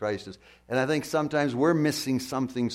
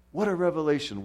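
ordinary talk, nothing marked

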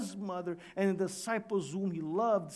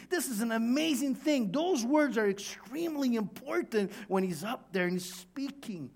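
usual speaking voice

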